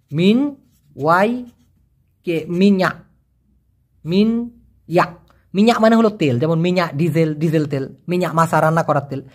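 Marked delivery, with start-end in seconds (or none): 0.1-5.3 মিন Y কে spelled slowly, then মিন yeah drawn out; slow syllable by syllable. Shown as a demonstration. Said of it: "আছে হচ্ছে মিয়া". (ধীরে, ভেঙে ভেঙে উচ্চারণ)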